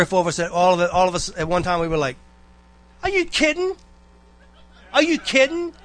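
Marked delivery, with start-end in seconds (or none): 3.0-5.7 very high, squeaky mock disbelief; incredulous outcry. Students reacting at once to the teacher's claim, high-pitched disbelief. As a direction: imitate a stunned class, voice shooting way up.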